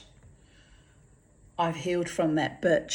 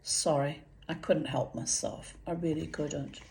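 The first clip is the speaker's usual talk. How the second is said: quiet aside; 9 dB quieter than usual. Real, not acted. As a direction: drop the voice for an offhand apology. Murmured apology for breaking off the reading.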